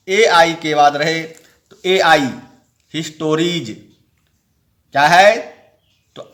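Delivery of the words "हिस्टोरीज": हिस्टोरीज ending in a clear ज sound; final s as j. Shown as a demonstration. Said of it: "तो जौ होगा".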